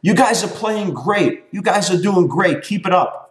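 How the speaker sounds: mock the over-encouraging parent; imitating cheerful parent. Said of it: "the parent next to me is saying".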